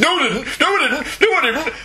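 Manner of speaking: put-on high, repeated childish-style protest; mock denial. Host imitating Michael denying he lost the comic, mock protest. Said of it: "And you said".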